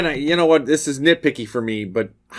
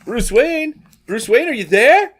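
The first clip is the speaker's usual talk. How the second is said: higher-pitched searching call; guy calling out; 6 semitones higher, 3 dB louder, slower than usual. Retold or imitated. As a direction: act out someone paging a missing guest. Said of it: "And the guy's like".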